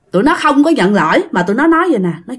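usual speaking voice

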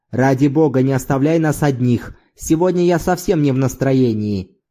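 ordinary delivery, nothing marked

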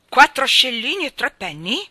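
loud, high, outraged question; deafening voice. A narrator's reading. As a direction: blast out your disbelief at the price. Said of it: "li aveva quasi assordati". (voce assordante, acuta e indignata)

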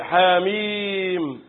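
حاميم very slow, the mim's vowel held long; long recited madd. Shown as a demonstration. Teacher gives students an example, long recited madd. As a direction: stretch the ee of حاميم on a steady note. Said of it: "واخد بالك".

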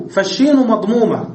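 normal talking voice

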